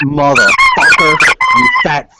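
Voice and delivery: screaming high and loud in real rage; furious scream. Real, not acted. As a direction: explode at him at the top of the lungs. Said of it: "The true [name] comes out".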